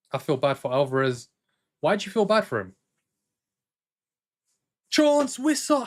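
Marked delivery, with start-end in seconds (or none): 4.9-5.9 excited shout. Real, not acted. Big chance during live match.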